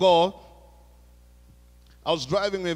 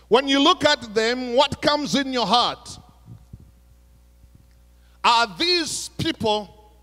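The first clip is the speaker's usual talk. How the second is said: raised, emphatic preaching to the congregation; 4 semitones higher, 5 dB louder than usual. Acted. Pastor challenging his congregation, preaching with force. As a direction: drive the challenge home from the pulpit.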